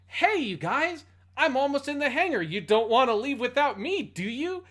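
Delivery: high, loud, carefree dub voice; cheesy dub voice. Retold or imitated. Podcast host voicing Duke Jose's cheery radio call, high and loud. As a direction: chirp it like an oblivious cartoon pilot. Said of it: "He goes".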